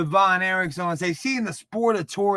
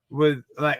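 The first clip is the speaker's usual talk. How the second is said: ordinary talk, nothing marked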